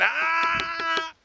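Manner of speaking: sharp high ah to stop the dog; correction sound. Shown as a demonstration. Trainer correcting dog jumping on counter, sharp high ah. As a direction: bark out a quick high ah to correct. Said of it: "get on the floor".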